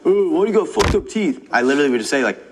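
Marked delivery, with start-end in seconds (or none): 0.0-1.5 mocking taunt. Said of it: "But I never am like".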